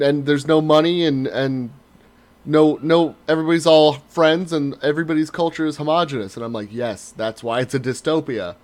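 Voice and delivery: normal talking voice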